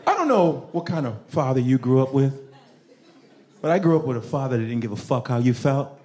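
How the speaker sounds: usual speaking voice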